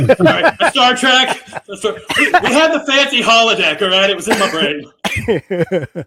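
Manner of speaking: laughing through the words, loud; cracking up at mixing up the ships. Real, not acted. Laughing at his own mix-up.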